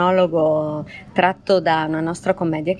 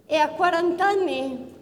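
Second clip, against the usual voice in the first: obsessive lovesick woman; 10 semitones higher, 3 dB quieter than usual. Acted. Obsessive lover's monologue.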